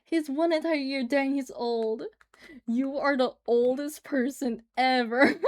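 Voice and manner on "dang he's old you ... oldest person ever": usual speaking voice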